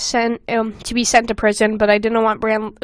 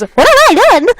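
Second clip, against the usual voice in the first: much higher and louder hammed-up despair; anguished cry; 10 semitones higher, 11 dB louder, faster than usual. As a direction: wail it like a melodramatic anime hero. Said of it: "Tears filled my eyes".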